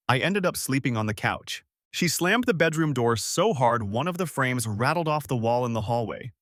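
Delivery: ordinary delivery, nothing marked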